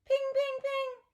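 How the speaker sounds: high bright ping sounds; spoon pings. A narrator's reading. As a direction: imitate a spoon on glass. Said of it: "clangs a spoon against a big jar".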